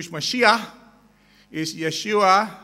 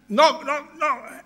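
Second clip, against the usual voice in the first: high, raised door-knock words; acted knocking; 8 semitones higher, slower than usual. Man at the Tokyo synagogue door, voicing the knocks high. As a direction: call out the knocks high and punchy.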